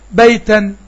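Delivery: بيتا with the final nun sound clearly heard, "bayta-n"; بيتا sounded out with the ending nun; nunation demo. Shown as a demonstration. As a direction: say بيتا and let the tanween ring as a nun. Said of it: "أو تنوين في الآخر أهو".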